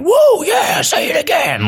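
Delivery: controlling hype. Retold or imitated. Vlogger making the Spanish kids repeat phrases, in a high shouted hype voice. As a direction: egg them on like a hype-man captor. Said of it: "controlling them".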